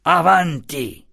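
high, drawn-out angry order; irate command. A narrator's reading. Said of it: "aveva irosamente gridato ai portatori".

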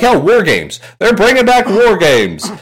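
high-pitched excited news-breaking; hyped-up retold excitement; mock hype. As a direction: announce it like thrilling news. Acts out hyped reaction to news.

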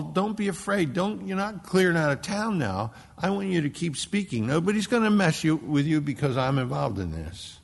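high, loud preaching at full force; fiery preaching. Acted. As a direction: preach it with fire. Preaching God's words to Paul.

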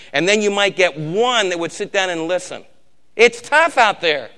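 loud, pounding pulpit delivery; loud preacher exhorting the congregation; full-force preaching. Acted. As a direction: drive it home to the congregation.

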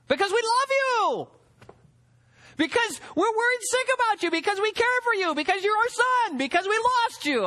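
high, urgent worried parent voice; anguished parents crying out; parents pleading. Retold or imitated. Parents answering Jesus, voiced emotionally.

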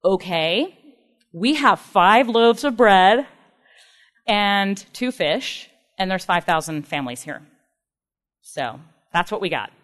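slow, low deadpan sarcasm with long pauses; dry, halting, unimpressed reply; sarcastic. Retold or imitated. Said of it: "you can hear the sarcasm".

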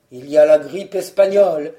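low, loud, grave answer; low, doom-laden husband; dire excuse. Acted. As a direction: pronounce the danger in a low voice. Husband invents another grim excuse.

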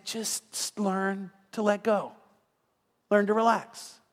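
slow, measured reassurance; acted calming voice of God; gentle counsel. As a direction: ease them into calm, word by word. God gently counsels the impatient preacher.

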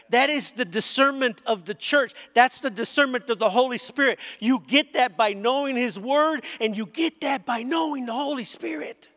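ordinary talk, nothing marked

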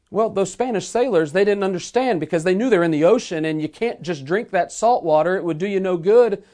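ordinary talk

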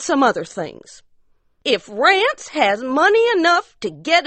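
high-pitched insistent mountain wife; high demanding wife. Acted. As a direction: press your claim, high and sharp. Ariella voiced, demanding wife.

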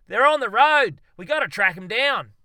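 very loud, high urgent call; rally Cliff to the chase; urgent shout. Acted. Darwin urges Cliff to track the monkeys, shouting.

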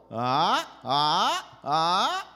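loud "ah" sliding upward each time; three forceful "ah"s, each climbing in pitch; rising tone. Shown as a demonstration. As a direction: push every "ah" uphill. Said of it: "when the car goes up the hill".

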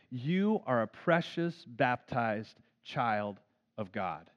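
slow, hushed blessing; tender blessing. Shown as a demonstration. Parent blessing a daughter at bedtime, slow and tender. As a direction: bless the child slowly and gently.

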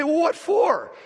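high, loud mock bewilderment; indignant surprise. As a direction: protest as if wrongly accused, pitch shooting up. Startled reply to arresting cop.

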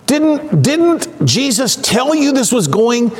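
high-pitched, drawn-out chiding question; incredulous scolding. Acted. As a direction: act out chiding slow pupils, stressing each reminder. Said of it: "He basically asks them if they're stupid".

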